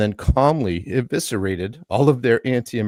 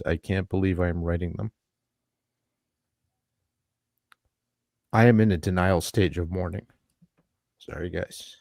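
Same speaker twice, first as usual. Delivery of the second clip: choked up. Real, not acted. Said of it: "it's hit me hard".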